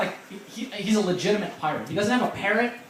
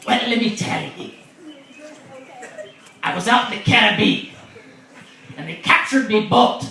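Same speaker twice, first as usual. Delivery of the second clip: loud, higher pirate storyteller voice; 4 semitones higher, 6 dB louder than usual. Acted. Pirate telling how he lost his leg, loud pirate voice. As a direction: play an old salt spinning his yarn. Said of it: "And he's like".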